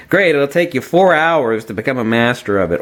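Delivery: normal talking voice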